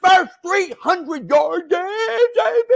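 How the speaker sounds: over-the-top hype on a big game; excited yell. Real, not acted. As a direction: yell it out in celebration. Hyping player's big game.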